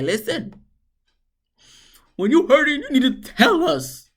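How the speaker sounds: high, wobbly imitation of a tearful plea; mock crying Rich. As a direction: ham up his teary speech. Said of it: "he started crying and shit".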